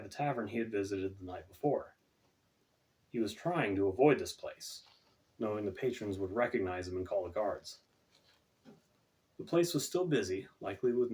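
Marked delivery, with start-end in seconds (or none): none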